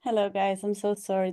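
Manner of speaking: ordinary talk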